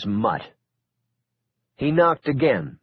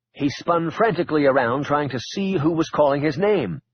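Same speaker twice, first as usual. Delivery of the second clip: plain reading voice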